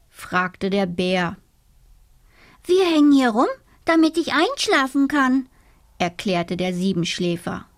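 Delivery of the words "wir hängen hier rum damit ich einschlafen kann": eager little dormouse explains. Acted. Dormouse explains to the bear, high and eager.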